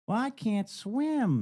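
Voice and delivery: put-on high, slow little-kid voice. Little kid telling John Wayne he can't swim, imitated child voice. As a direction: draw it out high like a helpless child. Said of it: "when the little kid".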